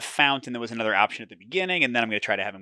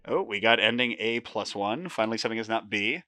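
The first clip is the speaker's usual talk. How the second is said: normal talking voice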